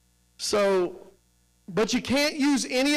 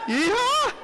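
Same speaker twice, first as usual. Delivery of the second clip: high, loud cowboy whoop; yeehaw; 3 dB louder than usual. Shown as a demonstration. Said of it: "Come on, I didn't hear you".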